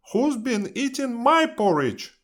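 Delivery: high-pitched mother bear. A narrator's reading. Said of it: "Mother Bear says".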